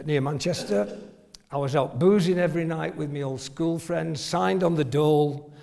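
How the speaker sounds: normal talking voice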